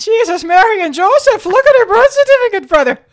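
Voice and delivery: loud, high hammed-up Irish accent. Joking to her cohost in a loud Irish accent. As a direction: belt it out in a stage Irish accent. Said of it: "we're gonna start talking Irish now".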